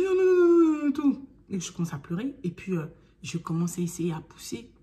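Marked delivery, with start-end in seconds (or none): 0.0-1.3 high, loud tearful pleading no; crying no. Retold or imitated. Mother in labor to midwife, crying and refusing. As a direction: sob out the refusals in panic.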